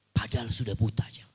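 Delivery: a quick hushed whisper; whispered remark to the congregation; whispered aside. Real, not acted. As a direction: drop to a breathy whisper for the aside. Aside after quoting blind elder.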